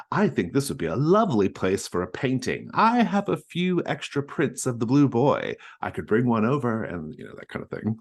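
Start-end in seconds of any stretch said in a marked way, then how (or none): 0.1-6.8 high, eager society widow; Mrs Stackpole voice. Acted. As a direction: chirp it up like a fussy hostess. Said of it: "she says".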